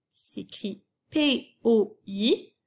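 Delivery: usual speaking voice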